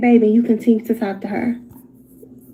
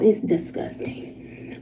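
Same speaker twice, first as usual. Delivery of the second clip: hushed, slow disgust; 2 semitones lower, 9 dB quieter, much slower than usual. Real, not acted. Streamer judging texts, hushed slow disgust. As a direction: let the disgust drip out softly.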